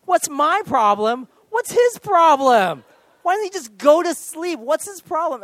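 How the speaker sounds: high, loud, hammed-up outrage; acted indignant yelling. Husband yelling back at wife about the baby, indignant shouting. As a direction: fire each question back at full voice.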